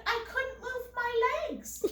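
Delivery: high-pitched mock excuse; high, plaintive mimicry; whiny mother imitation.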